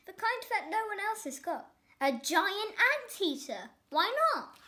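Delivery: put-on a spoilt boy thrilled with himself; eager, rising excitement; excited brat. A narrator's reading. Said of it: "Roy's idea".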